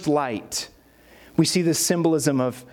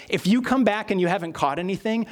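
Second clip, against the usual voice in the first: ordinary delivery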